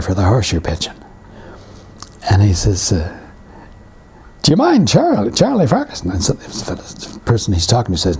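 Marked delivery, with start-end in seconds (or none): 4.4-6.1 high, farmer's Canadian rural twang; high-pitched Canadian rural farmer accent. Retold or imitated. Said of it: "at the way I said it".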